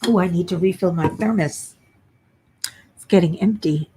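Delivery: ordinary delivery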